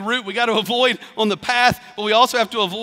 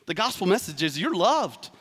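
usual speaking voice

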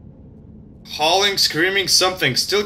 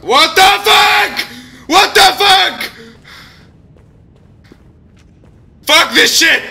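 panicked screaming; 12 semitones higher, 4 dB louder than usual. Real, not acted. Jump scare in horror game.

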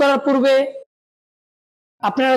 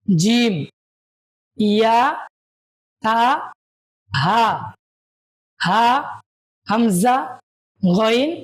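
reciting letters; much slower than usual. Shown as a demonstration. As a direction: clear letter-by-letter drill. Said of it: "আমার সাথে সাথে আপনারা বলুন". (শিক্ষকের মতো হরফ পাঠ)

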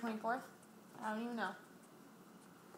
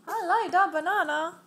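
put-on excited baby voice; 7 semitones higher, 12 dB louder than usual. Voicing baby's delight.

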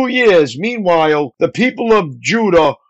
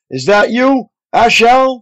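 higher, mildly raised voice, calling to someone behind; a light narrated call-out; 5 semitones higher than usual. A narrator's reading. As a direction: call out to check who's following. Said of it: "he called out".

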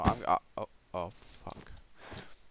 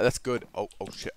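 ordinary delivery

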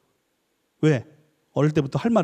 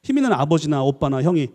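normal talking voice

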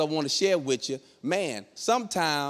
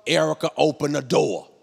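ordinary talk

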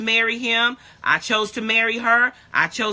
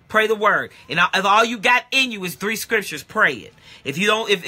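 ordinary talk

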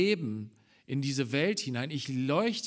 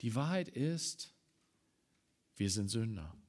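ordinary delivery

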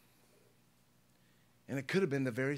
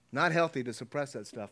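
usual speaking voice

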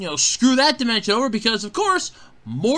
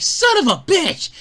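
higher-pitched mock outburst of frustration; mock exasperation; 8 semitones higher than usual. Acting out Morty as he hears the bad news, mock exasperated. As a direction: curse like a thwarted character, lightly hammed-up.

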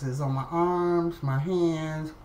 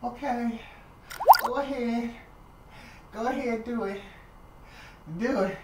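higher, shaky go ahead; bracing for pain; 7 semitones higher than usual. Retold or imitated. Patient bracing as the nurse pulls stitches, higher and tense. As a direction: brace yourself and tell her to just do it. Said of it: "And I'm like".

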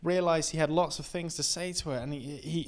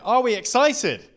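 excited; 9 semitones higher, 9 dB louder than usual. Real, not acted. Hyping up the crowd.

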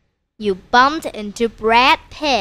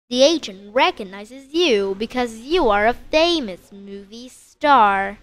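ordinary delivery

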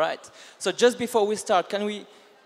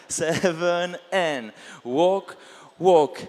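calling dance counts; 3 dB louder, slower than usual. Shown as a demonstration. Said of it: "teaching dance counts".

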